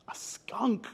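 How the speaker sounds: soft storyteller whisper; hushed reveal; whispered answer. Acted. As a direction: whisper it like a secret to children.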